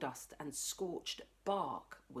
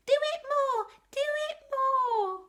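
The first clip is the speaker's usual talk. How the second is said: put-on much higher, louder little-kid yell; small child shouting; 7 dB louder than usual. Littlest child cheering the fallen tree, excited yell. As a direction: squeal like a delighted toddler. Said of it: "The littlest one shouted".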